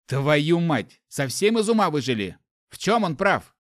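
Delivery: loud angry cursing; furious outburst. Acted. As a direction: blow up at the crowd. Hero swears at the crowd.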